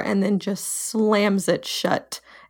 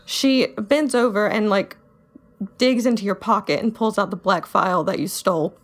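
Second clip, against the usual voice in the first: normal talking voice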